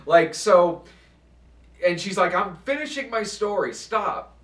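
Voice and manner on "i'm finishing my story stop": much higher-pitched imitation of an exasperated mom; mock indignant pushback; retold protest. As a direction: push back in a high imitated voice. Retold mother pushing back at son.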